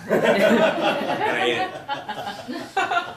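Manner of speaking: stretched-out, high, laughing words. Real, not acted. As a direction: drag it out laughing at the punchline. Laughing through the punchline.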